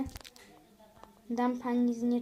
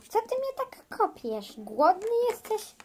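put-on baby talk; 8 semitones higher than usual. Mother talks to baby in belly.

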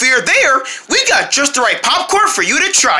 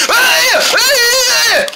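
excited shout; 12 semitones higher, much slower than usual. Acted. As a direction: shout out thrilled at the candy. Lollipop ad, excited cry.

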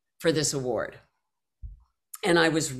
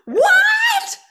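very high, loud, shrieking whats; screaming in disbelief; 7 dB louder than usual. Retold or imitated. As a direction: scream it out, overwhelmed. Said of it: "And I just started screaming".

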